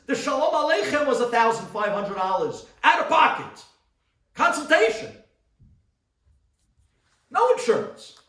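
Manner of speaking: a rabbi's full-throated sermon build; preaching at full force. Acted. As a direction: preach it out to the crowd. Preaches the doctor story.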